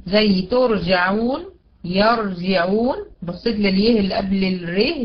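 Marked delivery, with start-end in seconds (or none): 0.4-3.0 slow ترجعون with thick vowelless R; drawn-out recitation, full R in ترجعون and يرجعون; heavy still ra. Shown as a demonstration. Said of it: "مفتوح أو مضموم تفخم".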